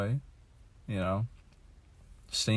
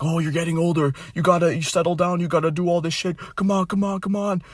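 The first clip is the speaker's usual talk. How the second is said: much higher, louder mock nagging; mock pushy nag; 9 semitones higher, 4 dB louder than usual. Vlogger mocks people urging him to settle down, high mock nagging. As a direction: parody the "settle down" pressure. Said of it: "they'll be like".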